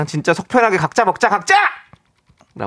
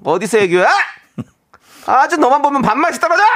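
angry; 7 semitones higher than usual. Acted. Reading angry listener message.

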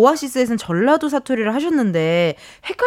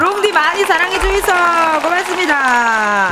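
high, hammed-up Daegu dialect; 7 semitones higher than usual. Host echoing the guest's film plug, high mock Daegu dialect. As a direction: copy a Daegu plug high, stretching the endings. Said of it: "대구 사투리로 한번".

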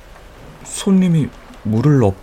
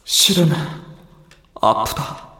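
pained; 13 semitones higher, slower than usual. Real, not acted. Admits the stepped foot hurts.

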